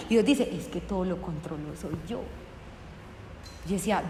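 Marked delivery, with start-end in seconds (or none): 0.5-2.2 God's voice. Retold or imitated. God answers her, voiced.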